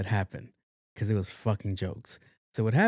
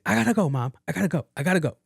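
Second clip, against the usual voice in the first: higher, insistent repeated sign-off; imitated flustered daughter; 7 semitones higher, much faster than usual. Daughter hanging up on mom, mock flustered. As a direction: cut the call short in a raised pitch. Daughter hurrying to end call.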